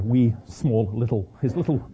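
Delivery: usual speaking voice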